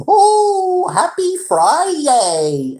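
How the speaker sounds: very high, slow, drawn-out mock cheer; sing-song happy friday. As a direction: ham up the fake-cheerful boss greeting. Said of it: "That's how he talked".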